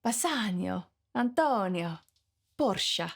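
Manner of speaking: names with an exotic Italian ring; Italian accent. Shown as a demonstration. Part-Italian teacher showing how the names should sound, Italian accent. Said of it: "Really, it should be".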